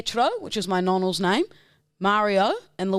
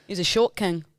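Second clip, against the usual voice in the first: ordinary delivery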